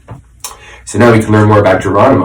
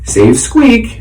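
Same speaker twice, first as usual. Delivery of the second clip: higher, punchy billboard slogan read; 7 semitones higher than usual. Acted. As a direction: sell it like a commercial.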